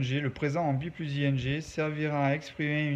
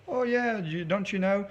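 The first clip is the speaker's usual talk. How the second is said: higher-pitched, put-on chatty English; acted casual announcement; 6 semitones higher than usual. Teacher pretending to share tomorrow's plans, acted chatty announcement. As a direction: play an example line, pitched up and drawn out at the end. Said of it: "je vous dirai".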